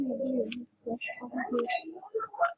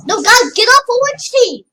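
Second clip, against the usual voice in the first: high, loud yell at teammates; frustrated yell; 9 semitones higher, 9 dB louder than usual. Real, not acted. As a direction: shout them off the team. Yelling at teammates.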